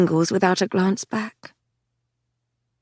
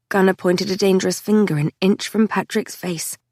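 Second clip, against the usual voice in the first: ordinary delivery, nothing marked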